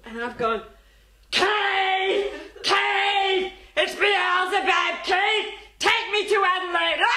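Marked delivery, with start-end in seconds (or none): 1.3-7.0 loud, high mock-possessed cry; crazed shout. Woman scaring the driver as a joke, crazed shout. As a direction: yell it like a woman gone demonic.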